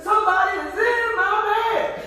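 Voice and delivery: put-on high, loud baby bear; baby bear finding Goldilocks in bed. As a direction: shriek it like a little cub. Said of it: "Then baby bear said".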